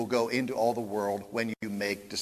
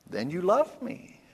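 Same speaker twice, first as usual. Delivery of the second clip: a touch of Tevye; 6 semitones higher than usual. Retold or imitated. Tevye concludes.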